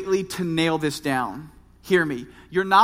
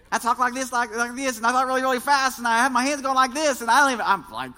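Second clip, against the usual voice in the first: higher, rushed twangy preacher imitation; mock your own fast, twangy delivery; twangy younger self; 4 semitones higher, faster than usual. Preacher mocking how he talked in his twenties, twangy and rushed. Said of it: "Did you notice the twang?".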